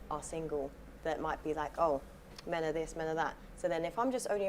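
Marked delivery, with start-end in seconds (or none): none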